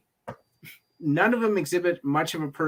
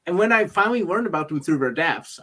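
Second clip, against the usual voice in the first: ordinary talk, nothing marked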